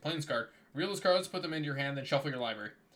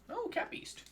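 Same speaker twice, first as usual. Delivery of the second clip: cutesy high voice; 4 dB quieter than usual. Acted. Cooing at a cat card.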